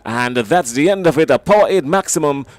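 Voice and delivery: loud, thrilled match-winning call; excited commentary. Real, not acted. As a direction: shout the winning six with delight. Winning six struck, commentator erupts.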